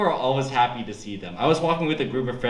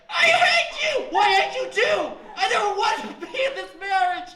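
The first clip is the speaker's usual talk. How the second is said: screaming couple fight. Pretend. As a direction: shriek like a spouse at breaking point. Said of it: "realistic version".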